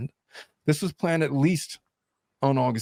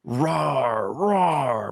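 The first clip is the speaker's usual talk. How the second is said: small child's mock lion roar; imitated lion roar. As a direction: roar playfully, without much volume. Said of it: "I'm roaring like a lion".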